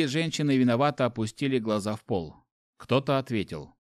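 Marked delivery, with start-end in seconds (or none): none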